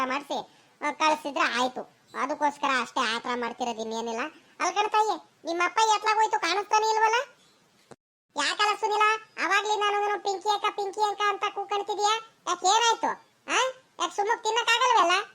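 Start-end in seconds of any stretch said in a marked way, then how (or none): 4.6-15.2 a raised, fussing elder's voice, voiced higher than her own; lightly voiced scolding relative, pitched up. A different character speaking up. (ಎತ್ತರದ ಧ್ವನಿಯಲ್ಲಿ ಗದರುವ ಹಿರಿಯರು)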